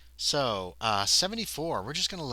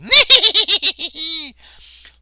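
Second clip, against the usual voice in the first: shrill cackle from above, loud; 20 dB louder than usual. Acted. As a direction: let out a high, loud witchy cackle. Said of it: "there's a cackle from above you".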